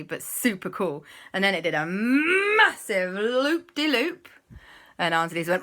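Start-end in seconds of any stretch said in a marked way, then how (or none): 1.9-4.3 stretched words, swooping upward; relishing the thrill. A narrator's reading. Storyteller on the big loop, swooping excitement. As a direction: draw it out like the loop itself.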